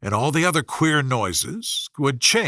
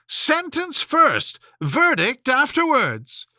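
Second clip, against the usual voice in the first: much higher, slow, commanding; imperious queen; 9 semitones higher, slower than usual. A narrator's reading. Queen overruling the king, high and imperious. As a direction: lay down the law. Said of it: "said the queen".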